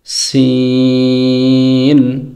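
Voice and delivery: long drawn-out sung letter name সিন; Arabic letter sound. Shown as a demonstration. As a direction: chant সিন slowly as in Quran recitation. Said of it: "কোরআন তেলাওয়াত করব".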